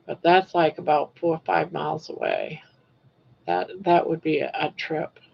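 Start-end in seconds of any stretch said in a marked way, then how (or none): none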